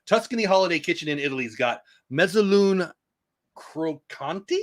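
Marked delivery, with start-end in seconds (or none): 3.6-4.6 "Crocanti" drawn out slowly, testing the Italian; unsure Italian attempt. Shown as a demonstration. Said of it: "I hope I'm saying that right".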